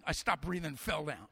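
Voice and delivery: ordinary delivery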